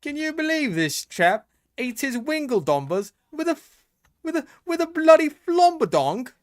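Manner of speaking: put-on high British accent building to a loud outburst; hammed-up Brit, rising to a cry; mock British accent.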